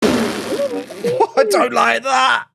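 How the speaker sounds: slow, drawn-out laughing groan; laughing dismay. Real, not acted. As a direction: laugh it out in mock horror. Opponent attacks, she laughs.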